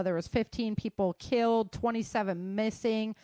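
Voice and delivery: ordinary talk, nothing marked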